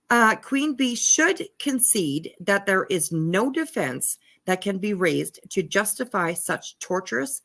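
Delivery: ordinary talk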